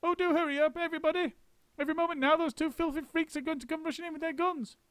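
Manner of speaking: very high, frantic urging; panicked about the guns; very high frantic monkey. A narrator's reading. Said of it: "He was frantic".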